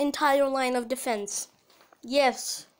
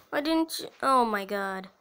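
ordinary talk, nothing marked

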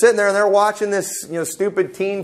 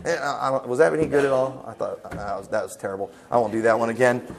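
low, soft, embarrassed mumble; self-deprecating, much quieter and lower; sheepish aside; 6 semitones lower, 6 dB quieter, faster than usual. Real, not acted. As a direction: drop right down, abashed at your own bad attempt. Sheepish after a failed impression.

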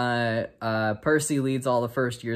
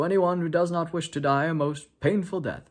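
a hint of a solemn old headmaster, pitched up; mock-grave warning to the whole school; 7 semitones higher, 3 dB louder than usual. Child retelling the feast, headmaster warns students, mock-solemn.

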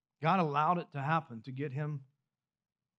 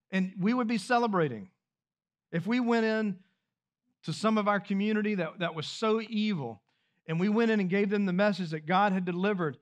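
higher and louder, building preacher's appeal; rising preaching; 5 semitones higher, 4 dB louder than usual. Acted. Pastor rousing the congregation, building preaching. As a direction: lift the congregation toward the question.

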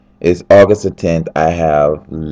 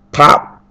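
slap sound effect; 9 semitones higher than usual. Retold or imitated.

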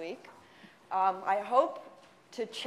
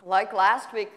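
ordinary talk

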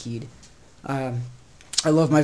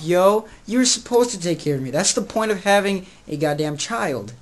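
ordinary talk

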